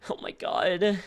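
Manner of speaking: high mocking voice of the guy; talking toilet. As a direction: mimic him high and whiny. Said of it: "That's how that guy talks".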